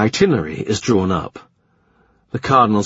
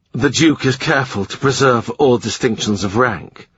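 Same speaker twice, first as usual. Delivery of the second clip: usual speaking voice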